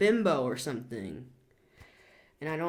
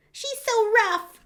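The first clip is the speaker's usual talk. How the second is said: high, slow, louder sneering quote; mocking a critic; 6 dB louder, slower than usual. Reader quoting a critic in a high mocking whine. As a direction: whine it like a snide commenter. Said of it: "So yes, I am rough".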